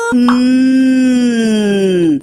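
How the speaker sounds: "N" as a hummed nasal sound, no "ene"; N sound shown. Shown as a demonstration. Teacher giving the children the N sound. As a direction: hum "N" through the nose, no vowels. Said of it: "cuál es el sonido característico".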